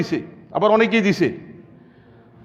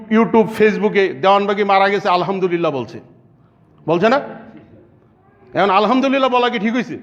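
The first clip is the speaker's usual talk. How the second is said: usual speaking voice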